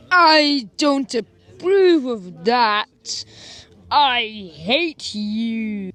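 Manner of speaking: loud, slow, stretched prissy voice; snooty voice. Retold or imitated. Girl offended by the boys, slow and loud. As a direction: drag it out all offended.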